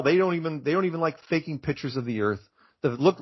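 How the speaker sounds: usual speaking voice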